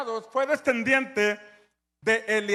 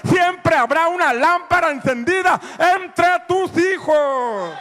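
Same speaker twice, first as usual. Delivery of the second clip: building from a firm preach to a loud, higher shout; preaching at full force; 3 semitones higher, 9 dB louder than usual. Acted. Pastor preaching to the congregation, rising to a shouted climax. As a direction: drive it home harder and higher to the end.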